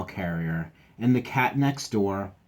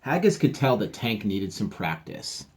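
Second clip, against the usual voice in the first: ordinary delivery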